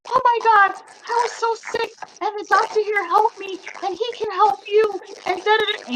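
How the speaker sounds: imitating an excited, high-pitched rambling woman; high, breathless-to-tell-you gushing in mimicry; pumped up. Excited receptionist gushing to a new patient about the doctor, pumped. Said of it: "she was, like, so pumped".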